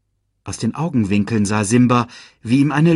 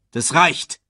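commanding; 6 semitones higher than usual. A narrator's reading. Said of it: "befahl Scar".

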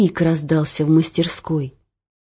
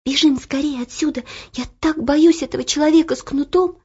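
put-on frightened girl; 8 semitones higher than usual. A narrator's reading. Frightened Elo urges flight.

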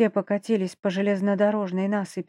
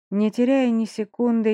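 ordinary delivery

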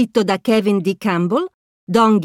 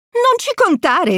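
higher, slow cheeky refusal; Mickey's reply; 7 semitones higher than usual. A narrator's reading. Mickey refuses the space pirate, cheeky higher reply. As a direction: brush off the pirate cheerfully. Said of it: "risponde Topolino".

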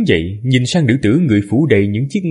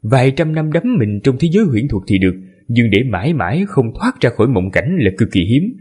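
plain reading voice

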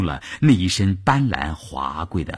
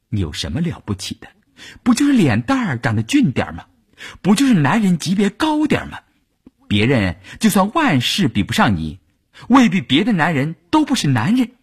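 quick jibes climbing higher; indignant scorn; 7 semitones higher than usual. A narrator's reading. Envious families sneering at Wu Yun, scornful. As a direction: needle them, ending high and indignant. Resentful families' thoughts, voiced.

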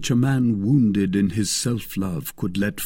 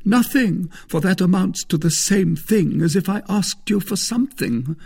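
loud, higher-pitched refusal; haughty Musketeer; 10 semitones higher, 4 dB louder than usual. A narrator's reading. Porthos refuses to ask the wife, haughty. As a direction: refuse with offended pride.

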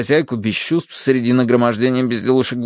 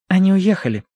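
higher, unhurried woman's voice; matter-of-fact young woman; 8 semitones higher than usual. A narrator's reading. Young woman tells the family they left, matter-of-fact. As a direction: state the news simply. Verity gives the news.

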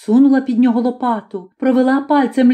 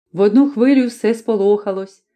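ordinary delivery, nothing marked

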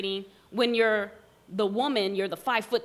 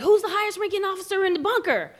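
urgent shout; 8 semitones higher, 4 dB louder, faster than usual. Retold or imitated. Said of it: "they're like".

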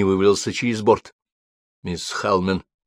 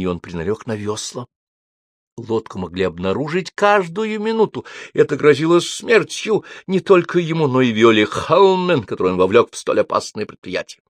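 plain reading voice

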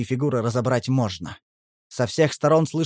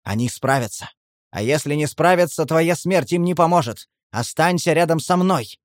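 usual speaking voice

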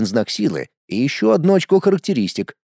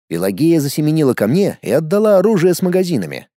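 plain reading voice

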